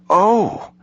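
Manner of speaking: high-pitched, abashed "oh"; sudden flustered understanding; embarrassed realization. A narrator's reading. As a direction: let out a high little oh. Embarrassed aide realizes the idiom.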